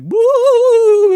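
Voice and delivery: loud, high banshee "woo"; piercing mock howl; banshee wail. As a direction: imitate a wailing ghost at full voice. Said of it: "and it's like".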